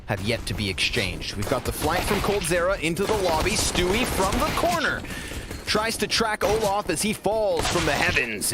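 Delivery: lively esports play-by-play call; play-by-play commentary. Acted. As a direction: call the action as it happens. Play-by-play of the pistol round.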